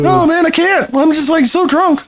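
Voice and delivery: high mocking voice of a tipsy teenager; drunk teen mockery. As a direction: brag like a kid after one beer. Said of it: "Fifteen-year-old's first beer".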